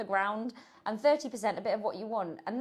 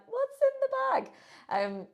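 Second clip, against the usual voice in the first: much higher, slow, drawn-out mock-suspicious ask; nosy question; 5 dB louder, much slower than usual. Teasing Will about the snacks in his bag, high and slow. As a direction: play it nosy and sing-song, stretched out. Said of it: "I'm like".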